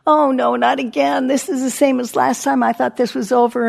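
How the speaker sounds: mock "not this again" complaint; dismayed reaction. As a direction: voice lifts in exasperated alarm. Acting out being triggered by pain.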